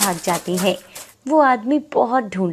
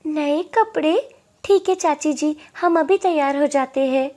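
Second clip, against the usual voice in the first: put-on young girl's voice; 7 semitones higher than usual. Girl's voice, raised pitch.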